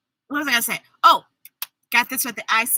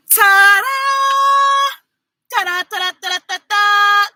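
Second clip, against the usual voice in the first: higher, louder ta-da; ta-da fanfare; 6 semitones higher, 3 dB louder, faster than usual. Real, not acted. Reader unveiling the book to the kids, bright ta-da. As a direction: present the book with a flourish.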